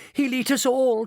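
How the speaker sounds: high, loud panicked cry; frightened cry. A narrator's reading. Mother panicking at the monster outside, crying out. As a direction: cry out in terror. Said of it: "cried Missus Bucket".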